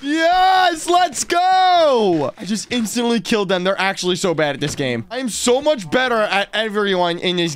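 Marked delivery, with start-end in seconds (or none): 0.0-2.3 excited cheer. Real, not acted. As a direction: whoop at the win. Cheers after killing attackers.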